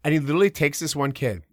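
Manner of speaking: normal talking voice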